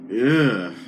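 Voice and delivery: drawn-out "ugh" of distaste; disgusted groan. Real, not acted. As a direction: groan it out, put off by the show. Recoils at a disliked show.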